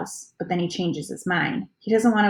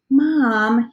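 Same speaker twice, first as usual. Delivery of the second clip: pleading; 5 semitones higher, 6 dB louder than usual. A narrator's reading. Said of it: "he pleads".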